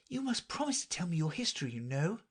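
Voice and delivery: put-on high, quick girl's request; Alice's voice. A narrator's reading. Alice asking the mouse for its history, high and quick. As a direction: ask lightly in a high character voice.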